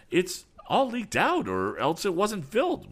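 high, loud despair; distraught, pitch way up. A narrator's reading. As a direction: answer in rising desperation. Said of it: "was the despairing answer".

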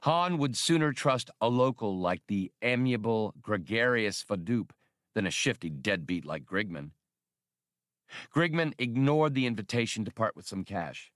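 ordinary delivery, nothing marked

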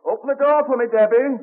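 high call through the door. Acted. Benjamin calls from the street for his wife Debbie to open the door. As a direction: call out to the shop.

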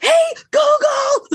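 very high, slow shout; shouting at the speaker over loud music; aggressive yell. Retold or imitated. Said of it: "really aggressively".

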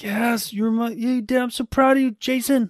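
high excited mock gushing; mock proud cheer. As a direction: fawn over him like a proud fan. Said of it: "you'd be there like".

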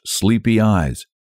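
ordinary delivery, nothing marked

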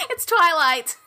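loud, high, emphatic announcement; loud and exasperated in fun; insistent correction. Real, not acted. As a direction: belt out the correct title. Insists on the real title.

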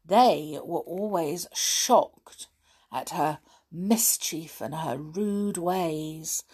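plain reading voice

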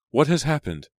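high, loud frightened cry; a mother crying out in alarm; mother cries out at her screaming daughter, high and loud. A narrator's reading. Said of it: "cried Jane".

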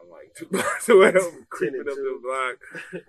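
ordinary talk, nothing marked

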